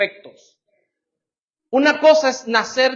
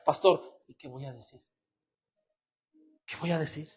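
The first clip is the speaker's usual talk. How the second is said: much softer, lower and shrunken, "¿qué voy a decir?" almost swallowed; timid mumble; 7 semitones lower, 12 dB quieter than usual. Retold or imitated. Preacher imitates a church member timidly dodging prayer, very soft. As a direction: mumble it like someone who feels worthless. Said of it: "mire cómo habla la descalificación".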